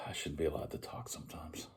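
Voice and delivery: self-mocking whisper. Real, not acted. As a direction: whisper it to yourself. Self-mocking aside after bad accent.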